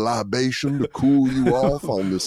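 hammed-up southern gentleman voice; a mock old southern accent; Foghorn Leghorn drawl. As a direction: lay the southern charm on thick, mock flirting. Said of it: "gone full Foghorn Leghorn".

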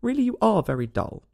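cross, but kept light; angry. A narrator's reading. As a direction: scold with storybook exasperation. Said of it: "said the mock turtle angrily".

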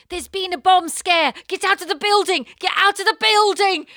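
high, loud urgent order; mock police bobby. English bobby ordering her out of the mall, loud and urgent. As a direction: shout the evacuation like a cop. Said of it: "and he goes".

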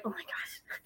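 soft unvoiced whisper; whispered aside. To her crying friend mid-hug, a soft whisper. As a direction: breathe it out with no voice.

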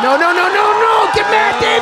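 high, climbing yell; desperate pleading shout. Real, not acted. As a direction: beg your man not to give in. Wrestler about to lose.

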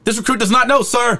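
high-pitched recruit's shouted reply; mock recruit yell. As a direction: sound off to the instructor, exaggerated.

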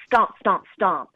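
each stomp punched out loud and heavy; stomping sounds. Acted. Narrator voicing the monster's stomps on the way, heavy and loud. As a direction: thump out the footsteps like a big monster.

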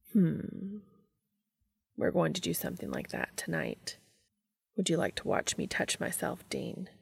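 soft seductive murmur; Cass, hushed offer. A narrator's reading. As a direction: lean in and tempt him quietly.

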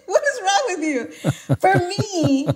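giggly, amused self-mockery; laughing. Real, not acted. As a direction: crack up at your own rant. Laughs at own rant.

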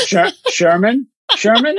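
high, questioning SHERMAN, the R overdone; attempted imitation. Guest checking with co-host, overdone R in a high "Sherman". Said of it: "Am I saying it right now?".

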